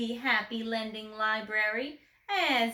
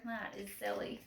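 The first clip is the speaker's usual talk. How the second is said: ordinary delivery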